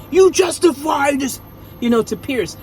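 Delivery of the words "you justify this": high, loud mock Austrian strongman; Arnold Schwarzenegger. Angry champion demanding answers from Pierce, in a mock Austrian accent. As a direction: bark it like an action-movie Austrian. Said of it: "Gunther was like".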